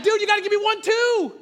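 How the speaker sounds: high-pitched, loud, comic yell; alarmed protest. Retold or imitated. Father begging his jumping son for warning, yelling. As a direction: shout in mock panic.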